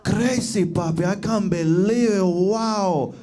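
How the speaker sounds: put-on leering Dominican accent, drawn out; slow, admiring Dominican drawl; Dominican cab driver. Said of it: "he was like".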